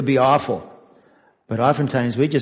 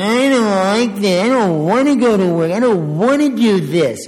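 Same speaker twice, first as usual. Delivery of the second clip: whining complainer; 6 semitones higher, 4 dB louder than usual. Retold or imitated. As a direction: whine like someone who hates their job. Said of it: "and whining".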